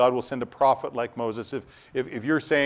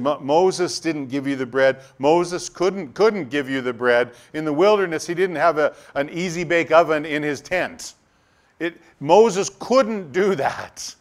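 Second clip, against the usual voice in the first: emphatic correction; 4 semitones higher, 6 dB louder than usual. Retold or imitated. Jesus voiced correcting crowd.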